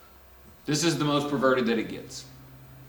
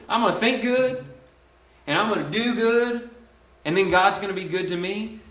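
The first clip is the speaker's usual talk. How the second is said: higher, mock eager do-gooder voice; mock self-reliant believer; 6 semitones higher than usual. Preacher mocking a works-minded believer, higher mock eager voice. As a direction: sound naively pleased with your own plan. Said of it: "if the idea is".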